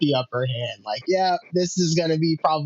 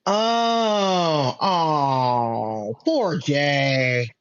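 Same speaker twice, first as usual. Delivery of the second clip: mock pity; much slower than usual. Fan reacting to poor Jay's situation, mock pity. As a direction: coo in exaggerated sympathy.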